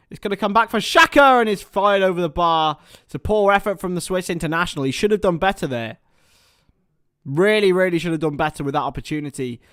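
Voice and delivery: building match commentary, rising into the kick-off; live play-by-play. Acted.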